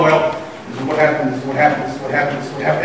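low, quiet repetitive run-through; soft, looping recital; rehearsing under the breath. Retold or imitated. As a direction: practise the excuse to yourself. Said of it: "sounds a little rehearsed".

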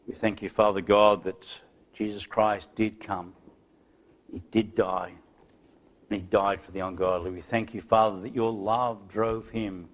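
low, soft reverent prayer; real, subdued pastoral praying with pauses. Real, not acted. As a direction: lead the congregation in hushed thanks to God. Leads the closing prayer.

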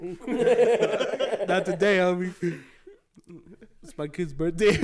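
drawn-out, loud mock refusal through laughter; laughing role-play. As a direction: play it cocky while cracking up. Riffing on the coupon, cracking up.

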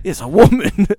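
high, loud mock Australian; hammed-up Australian disbelief; Australian accent. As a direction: announce it as a flabbergasted Aussie. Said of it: "He does Australian".

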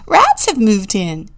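loud, thrilled bunny announcement; excited little bunny yell; excited shout. A narrator's reading. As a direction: shout the big news. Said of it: "shouted Rosie".

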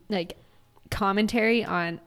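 ordinary talk, nothing marked

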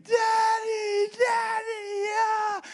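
high, loud wailing cry of Daddy; crying scream. Retold or imitated. Pastor retells his daughter chasing his truck, high crying scream. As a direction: wail it like a small child sobbing for her father. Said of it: "bust out the door of the garage screaming".

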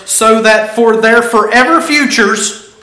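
normal talking voice

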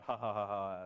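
low, spoken mock ha-ha; put-on derisive laugh; mock laughter. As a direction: imitate scoffers laughing, low and unamused. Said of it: "they're laughing on the way out".

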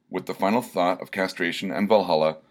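plain reading voice

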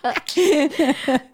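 talking through real laughter, high-pitched; amused protest breaking into laughs; laughing. Real, not acted. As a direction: crack up while saying it. Joking over the studio mixer.

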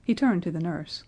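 usual speaking voice

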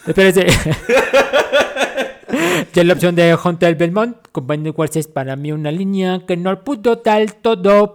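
loud commanding general reading. Acted. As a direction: announce it like an army general. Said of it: "que lo lea el general".

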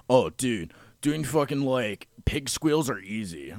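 loud, cocky retold brag; bragging loudly; loud boast. Retelling a buddy's boast.

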